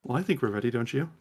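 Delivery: softly voiced villain's remark; quiet aside. Acostus speaks to Valis in the distance, quietly.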